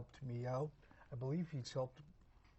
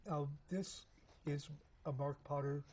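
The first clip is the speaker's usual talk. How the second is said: usual speaking voice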